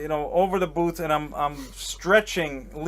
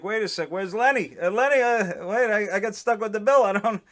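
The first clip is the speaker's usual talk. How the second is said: higher, flustered stammer; a touch of alarm, pitched up and halting; mild mock panic; 6 semitones higher, 4 dB louder than usual. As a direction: act out being stuck with the bill. Acting out alarm at unpaid bill.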